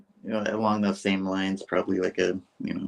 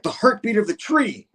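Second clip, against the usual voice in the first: high, theatrical proclamation; hammed-up cameraman; 12 semitones higher, 4 dB louder than usual. Cameraman revealing his theory to the scientists, hammed-up and high. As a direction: announce it like a mock-mystical revelation. Cameraman's dramatic line voiced in retelling.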